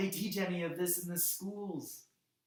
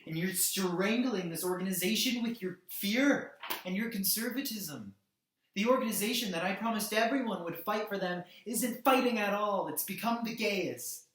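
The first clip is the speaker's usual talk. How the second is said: loud, raised-pitch angry tirade; impassioned accusation; 3 semitones higher, 5 dB louder than usual. Acted. Activist berating his own organisation for not fighting, angrily. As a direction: hurl the accusations, pushing key words hard.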